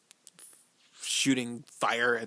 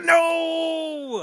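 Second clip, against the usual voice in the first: a high, loud crying yell; anguished shout; 11 dB louder than usual. Real, not acted. Fan watching the hero die on screen, crying out. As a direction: wail it like you can't bear to watch. Reacting to character's death.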